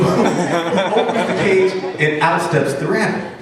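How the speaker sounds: plain reading voice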